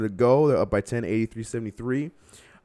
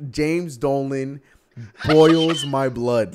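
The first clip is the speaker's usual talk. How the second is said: angry; 3 semitones higher, 7 dB louder, much slower than usual. Real, not acted. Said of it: "I'm genuinely pissed off right now".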